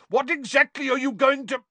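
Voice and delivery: indignant shout; bellowing. A narrator's reading. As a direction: bellow a demand, cut off sharply. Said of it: "he bellowed at the fire".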